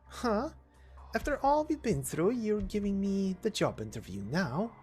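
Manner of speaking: incredulous. Acted. Incredulous reply to being questioned.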